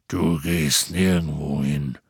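tiefe, langsame Riesenstimme; knurrender Hagrid. A narrator's reading. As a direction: brumm es tief und bestimmt wie ein Halbriese. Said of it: "knurrte Hagrid". (tief geknurrt, langsam und bestimmt)

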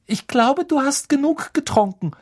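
high-pitched, strict telling-off; stern girl. A narrator's reading. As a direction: scold firmly in a high voice. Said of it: "sagte Hermine streng".

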